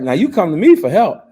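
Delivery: loud, high, scolding father; a father raising his voice at his son; stern father shout. Retold or imitated. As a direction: lay down the law at full volume.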